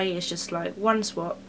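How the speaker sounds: normal talking voice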